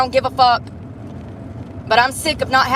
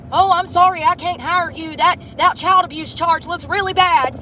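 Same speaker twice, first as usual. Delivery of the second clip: higher, prissy mock employer voice; parroting the hiring manager, pitched up; mocking the interviewer; 6 semitones higher than usual. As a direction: sneer it like the man who turned you down. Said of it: "Yeah, I know, sir".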